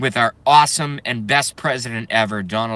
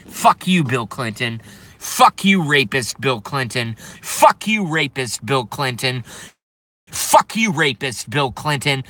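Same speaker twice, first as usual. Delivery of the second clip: furious shouting. Real, not acted. Ranting vlogger yelling curses at Bill Clinton, furious. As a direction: spit each curse out in real rage.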